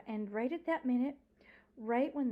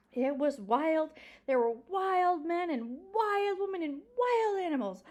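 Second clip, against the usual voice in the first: put-on old librarian storyteller; 9 semitones higher, 6 dB louder than usual. Old librarian telling a child of wild Florida, with dramatic relish. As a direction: stretch and swoop like a delighted tale-teller.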